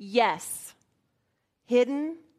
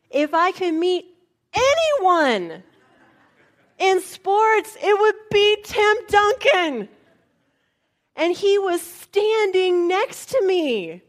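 higher and louder, bursting with fan excitement; excited gushing; 6 semitones higher, 7 dB louder, slower than usual. Real, not acted. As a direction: gush like a starstruck fan. Gushing about her favourite athlete.

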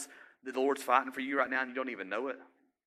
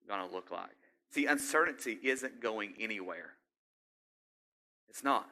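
ordinary talk, nothing marked

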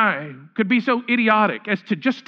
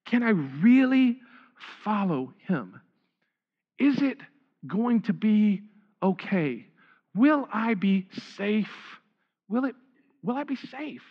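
quiet, hesitant voice of someone who doubts; doubting worrier; 4 dB quieter than usual. Retold or imitated. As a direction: ask it softly, as if afraid to trust. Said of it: "goes something like this".

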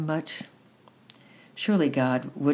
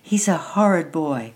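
louder, higher scolding; cross; 3 semitones higher, 5 dB louder than usual. A narrator's reading. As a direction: warn the child off crossly. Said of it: "said Annette crossly".

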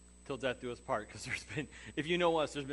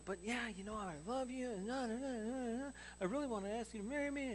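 nervous rambling; 8 semitones higher, 5 dB quieter than usual. Retold or imitated. Nervous fumbling proposal talk.

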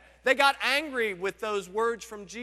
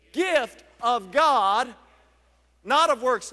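gift of God drawn out, loud; slow emphatic stress; 6 dB louder, slower than usual. Acted. Preacher drives home the verse, slow and loud. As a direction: lean hard on each word.

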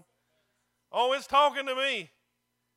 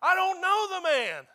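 loud, raised-pitch angry denial; cursing denial; 6 semitones higher, 6 dB louder than usual. Acted. Preacher acting out Peter denying Jesus, a heated outburst. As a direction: deny it hotly, higher and firm. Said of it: "a word and cursed and said".

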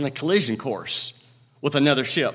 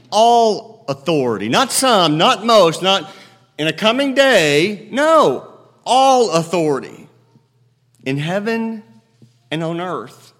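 preaching at full force; 6 dB louder than usual. Acted. Preacher to his congregation, loud and ringing. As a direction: proclaim it to the whole room.

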